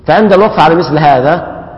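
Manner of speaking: usual speaking voice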